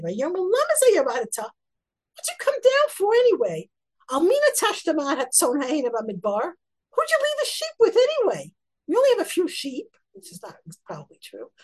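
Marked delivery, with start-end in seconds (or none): 0.0-9.9 sharp, loud, high-pitched rebuke; harsh scolding. Acted. Eliab snapping at his little brother David, harsh and loud. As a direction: bark it at him like a scornful big brother. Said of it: "he says to David very harshly".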